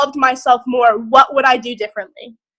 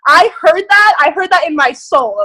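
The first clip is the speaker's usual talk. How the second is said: excited; 4 semitones higher, 5 dB louder than usual. Real, not acted. Excited affirmation to Tanya.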